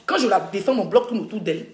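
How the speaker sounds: ordinary talk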